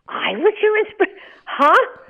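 high, loud disbelief at Kerry Washington's praise; stunned disbelief. Retold or imitated. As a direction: cut yourself off in amazement.

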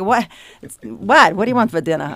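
put-on loud, playful South Boston Irish accent at home; teasing husband in thick Southie accent.

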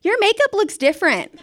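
put-on high, loud kid voice; mock schoolkid's blunt observation; imitated ten-year-old. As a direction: pipe up like a tactless child. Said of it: "ten-year-olds being like".

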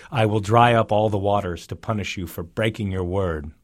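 plain reading voice

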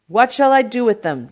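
loud, high, exasperated question; shouting. A narrator's reading. Produce man protests to uncle, shouting. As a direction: shout it down the phone. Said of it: "D'Agostino shouted".

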